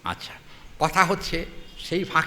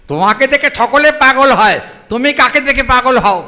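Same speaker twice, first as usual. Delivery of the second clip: teasing challenge; 8 semitones higher, 13 dB louder than usual. Acted. Lalita teasing Krishna.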